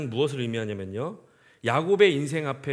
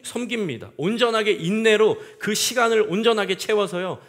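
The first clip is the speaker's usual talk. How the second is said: higher, louder impassioned preaching; preaching at full force; 6 semitones higher, 6 dB louder than usual. Acted. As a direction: drive the point home to the congregation. Preacher building intensity. (높고 크게 열정적으로 설교)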